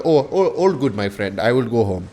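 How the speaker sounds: halting mock Indian accent. Prankster retreating from homeowner, mock Indian accent. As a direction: back off in a put-on Indian accent. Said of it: "I obviously do my Indian thing".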